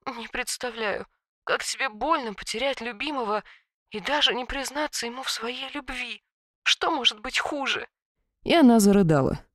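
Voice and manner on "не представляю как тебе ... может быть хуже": mumbling. A narrator's reading. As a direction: murmur it through sorrow. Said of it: "И Касиль пробормотала".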